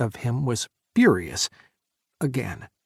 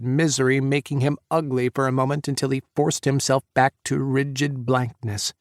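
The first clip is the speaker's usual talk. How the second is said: usual speaking voice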